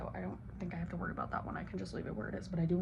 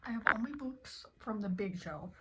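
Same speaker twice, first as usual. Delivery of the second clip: normal talking voice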